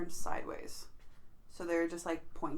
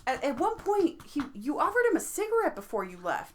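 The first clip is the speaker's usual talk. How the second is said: high, eager question; excited; 8 semitones higher, 4 dB louder than usual. Real, not acted. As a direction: ask it all worked up.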